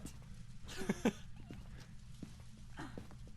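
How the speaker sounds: high breathy giggling; high giggle. Real, not acted. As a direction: giggle at the joke. Laughing at own joke.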